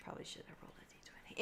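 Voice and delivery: fast whispered aside; quick rueful whisper to herself. Real, not acted. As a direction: rush it out under your breath. Muttered aside after roll.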